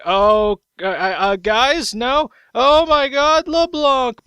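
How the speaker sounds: panicked Nidalee. Pretend. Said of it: "Nidalee's like".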